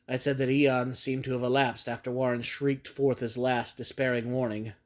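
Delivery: plain reading voice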